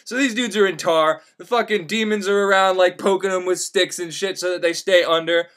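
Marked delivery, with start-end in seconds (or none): none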